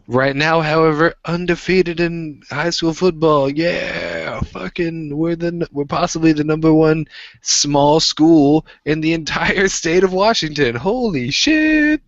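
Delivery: high, loud hometown football hype; hyped-up bragging. Acted. Bragging about his high school team, hyped up.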